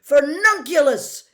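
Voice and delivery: shouted curse at full force; yelled spell. A narrator's reading. Harry firing a curse at Malfoy, full yell. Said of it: "Harry yelled".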